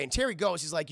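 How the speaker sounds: ordinary talk, nothing marked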